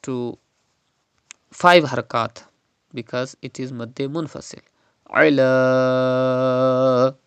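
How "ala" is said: "Ala" with a long, level final vowel; elongated madd. Shown as a demonstration. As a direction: hold the end of "Ala" for five counts. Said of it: "like this".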